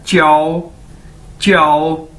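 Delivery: "च्याओ" drilled with pauses, opening on "ch"; model pronunciation. Shown as a demonstration. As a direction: teach "च्याओ" as one drawn-out, run-together word. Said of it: "तो word क्या है?".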